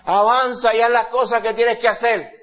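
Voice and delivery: loud, high, urgent cry to God; groaning plea. Acted. Preacher voices groaning creation begging the Lord to hurry, loud urgent plea. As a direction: plead insistently, as if creation can wait no longer.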